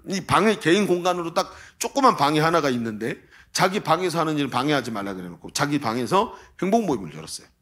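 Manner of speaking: ordinary talk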